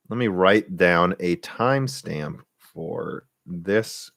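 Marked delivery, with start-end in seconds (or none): 1.4-4.1 slow, halting, words drawn out; distracted while writing. Real, not acted. Streamer noting a timestamp, slow and trailing off quietly. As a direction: stretch the words as you jot a note.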